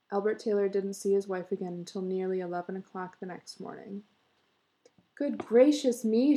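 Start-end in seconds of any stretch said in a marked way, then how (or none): 5.1-6.4 higher, louder, drawn-out exclamation; startled cry. A narrator's reading. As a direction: cry out in astonishment. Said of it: "she cried".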